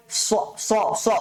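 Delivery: স in short bursts with heavy rounded vowel; short thick s. Shown as a demonstration. Teacher shows students how to practise, short heavy স. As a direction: repeat স thickly, one short beat each. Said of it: "এভাবে করে".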